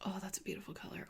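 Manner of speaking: quick hushed delight; fast awed whisper. Real, not acted. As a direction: breathe it out in awe. Awed by the colour.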